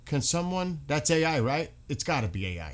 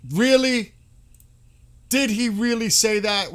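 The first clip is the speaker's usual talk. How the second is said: stunned disbelief; 9 semitones higher, 7 dB louder than usual. Real, not acted. Shocked by a reported post.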